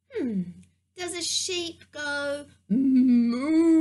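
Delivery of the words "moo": long, loud, drawn-out cow moo. Pretend. Storyteller guessing a sheep's sound for kids, mooing like a cow. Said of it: "You're a cow".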